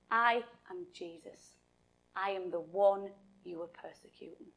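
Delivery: low, soft and measured; hushed divine voice. Acted. Jesus answering Paul on the road, low and hushed. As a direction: reveal yourself quietly, with still authority.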